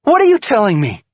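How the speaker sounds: high, loud, fast demand; shouted accusation; furious demand. Acted. Wife confronts cheating husband.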